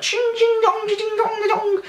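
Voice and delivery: high sung coin noises; a high ding-dong of coins; coin jingle imitation. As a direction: mimic the coins clinking in his pocket. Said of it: "I could hear all his money".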